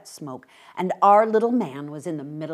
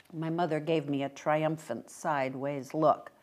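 plain reading voice

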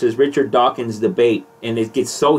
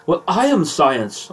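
slow, lightly British proclamation; pompous declaration, a hint of British; Dawkins impression; 5 semitones higher, slower than usual. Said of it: "I got a little Dawkins in me".

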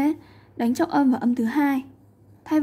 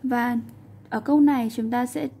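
ordinary talk

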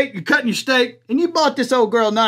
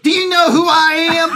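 higher, louder, laughing through it; mock entitled shout; 7 semitones higher, 4 dB louder than usual. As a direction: play her demanding outburst. Quoting her entitled outburst.

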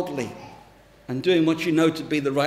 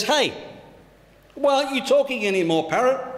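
higher, quick, mock-exasperated question; a touch of acted frustration; mildly frustrated; 6 semitones higher, faster than usual. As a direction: demand an answer, lightly acted. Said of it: "frustrated, the man says".

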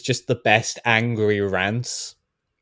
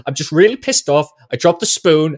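petulant rant; 6 semitones higher, 7 dB louder than usual. Retold or imitated. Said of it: "It's just".

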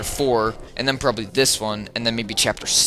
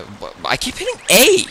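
frustrated; 10 semitones higher, 5 dB louder than usual. Real, not acted. Frustrated at controls.

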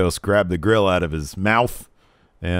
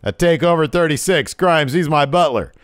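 loud, high wrestling-promo shout; brash challenge; 9 semitones higher, 6 dB louder than usual. Retold or imitated. L A Knight challenging Million Dollar Man, loud and brash. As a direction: call him out like a heel on the mic.

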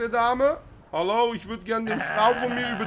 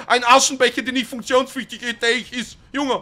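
loud mock outburst; 6 semitones higher, 7 dB louder, slower than usual. Mocking son's workshop gift.

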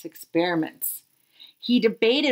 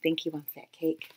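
quiet aside; 6 semitones lower, 9 dB quieter than usual. Acted. Narrator's aside.

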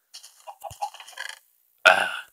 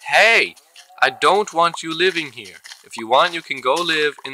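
loud and higher, shooing an animal away; indignant shout at an unwanted guest; shouting at mob; 5 semitones higher, 3 dB louder than usual. Real, not acted. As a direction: yell to chase it out of the house. Yelling at a mob in house.

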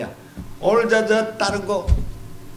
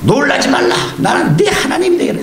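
voicing God; 7 semitones higher, 3 dB louder than usual. Preacher voices God's promise.

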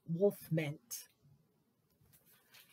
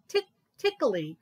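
plain reading voice

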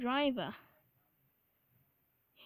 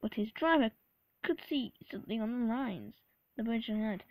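ordinary delivery

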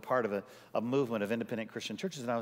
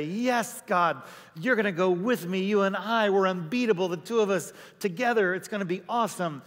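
much higher and louder, hammed-up cheer; mock excited Moses; 8 semitones higher, 6 dB louder than usual. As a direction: play Moses pumped up with God on his side. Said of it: "you would think that our Bible guy".

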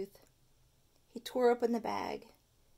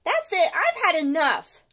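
higher, much louder outburst; fed-up cry; 7 semitones higher, 10 dB louder than usual. Acted. Stuart fed up with his tooth, loud. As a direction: put your foot down. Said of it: "cried Stuart".

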